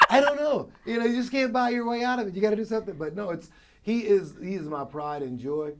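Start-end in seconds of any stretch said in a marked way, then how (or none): none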